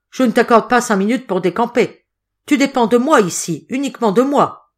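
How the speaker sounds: high, forceful browbeating; stern, angry threat. A narrator's reading. Hotelier showing the boy who is master, angry threat.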